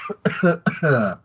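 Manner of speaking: harsh hacking cough; coughing fit. Real, not acted. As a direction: cough hard from a cold. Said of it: "I'm having a little bit of a coughing fit".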